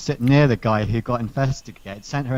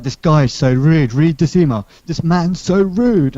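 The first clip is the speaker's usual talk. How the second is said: loud imitation of outraged coworker, rising higher; mock indignation; 5 semitones higher, 7 dB louder than usual. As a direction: complain hotly, stretching out the outrage. Acting out coworker's indignant complaint.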